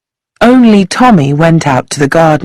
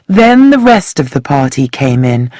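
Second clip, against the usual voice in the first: ordinary delivery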